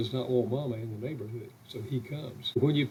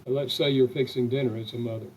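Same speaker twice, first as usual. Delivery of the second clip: ordinary talk, nothing marked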